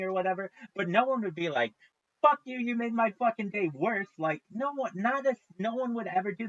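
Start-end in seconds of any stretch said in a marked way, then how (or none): 2.2-4.1 high, louder imitation of an angry stranger; mock hostile outburst. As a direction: act out an over-the-top rude reply.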